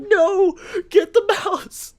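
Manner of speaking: high, very loud frantic dad; mock panicked yell. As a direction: yell it slowly like an alarmed dad. Acted panicking dad in story.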